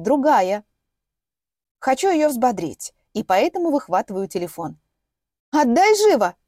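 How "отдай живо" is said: angry demand. Acted. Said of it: "Сестра ядовито зыркает".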